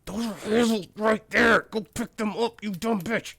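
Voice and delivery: loud, high angry yell at the dwarves; furious shout at lazy workers. Real, not acted. Gamer yelling at dwarves to grab the fruit, furious shout. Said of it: "I'm yelling at them so much".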